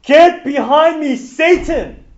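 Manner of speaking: stern rebuke. Acted. Jesus rebukes Peter before the disciples, loud and stern. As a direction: cast him out with authority.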